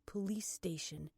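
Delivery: ordinary delivery, nothing marked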